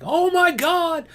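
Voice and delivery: very high, drawn-out squeal; hammed-up hysterical cry; mock freaking out. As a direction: imitate people in a panic, stretched and shrill. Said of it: "people were freaking out".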